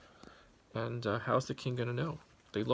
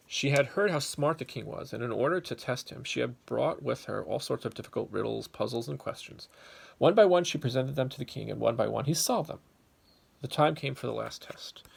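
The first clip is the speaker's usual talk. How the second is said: louder, animated storybook telling; lively story narration; 4 dB louder than usual. A narrator's reading. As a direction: tell it big for the kids. Reads story to children.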